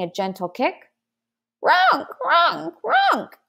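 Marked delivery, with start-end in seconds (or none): 1.6-3.4 high, honking creature cries; screeching baby dragon. A narrator's reading. Dragonling protests the gentle kick, screeching. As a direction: squawk it like an upset dragonling. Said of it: "it screeched".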